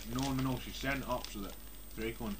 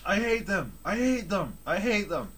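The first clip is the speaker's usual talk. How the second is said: mock goth; 7 semitones higher, 6 dB louder than usual.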